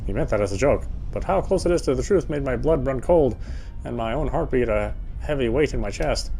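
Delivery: ordinary talk, nothing marked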